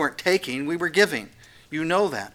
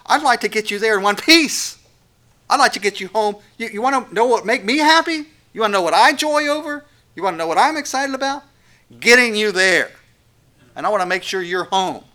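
higher, louder, eager longing to bring them home; eager parent; 7 semitones higher, 8 dB louder than usual. Acted. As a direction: let the joy of getting them there ring out. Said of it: "You can hear the parent in him".

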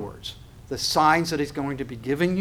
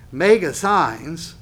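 "mega signs" drawn out slow and louder; slow emphasis; 6 dB louder, much slower than usual. Shown as a demonstration. Preacher stressing the Greek word "mega signs" slowly and loudly. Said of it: "watch the phrase".

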